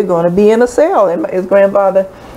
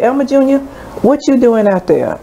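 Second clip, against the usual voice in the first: higher voiced grandfather calling the boy; 8 semitones higher than usual. Grandfather calling to Elmer on the step outside.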